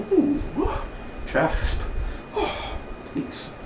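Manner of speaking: soft whispered sighing plea; whispered swooning plea. Retold or imitated. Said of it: "he was like".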